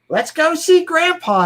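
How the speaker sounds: high, drawn-out mock daughter's voice; imitating daughter. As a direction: mimic your daughter talking to the puppy, high and slow. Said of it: "I hear her say".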